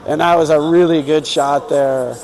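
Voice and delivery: ordinary talk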